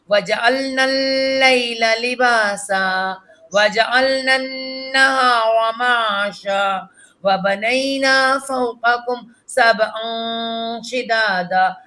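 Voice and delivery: slow, measured tilawat; slow melodic Quran recitation. Acted. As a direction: chant each verse slowly and evenly for the pupil. Leads the recitation.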